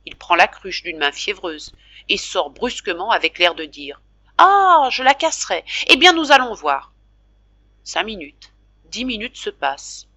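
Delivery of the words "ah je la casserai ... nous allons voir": loud, high, defiant challenge; defiant outburst. Acted. Jacques storming out with the jug, loud defiance. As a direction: throw down the dare.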